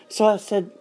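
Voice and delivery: ordinary talk, nothing marked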